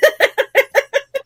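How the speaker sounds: very high, loud rising laugh. Real, not acted. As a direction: crack up at the joke. Laughing at spin-off series.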